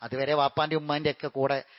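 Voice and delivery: the preacher resumes gently after the pause, calm and low; calm second khutbah. Acted. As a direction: settle into a quiet, measured closing sermon.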